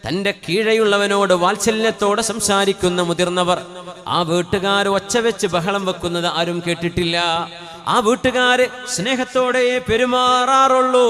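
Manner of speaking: melodic sermon chant, sustained and projected; intoned religious sermon delivery; sing-song preaching. Real, not acted.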